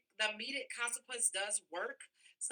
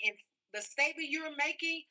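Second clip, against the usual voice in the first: ordinary delivery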